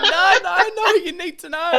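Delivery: loud, very high mock wail through laughter; comic dismay. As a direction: protest in hammed-up anguish, half laughing. Acting out own dismay, laughing.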